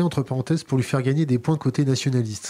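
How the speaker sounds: ordinary delivery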